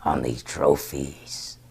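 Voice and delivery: low, slow whispered robot voice; slow low robotic whisper; Soundwave reply. Retold or imitated. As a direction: answer in a cold hush. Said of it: "He's like that".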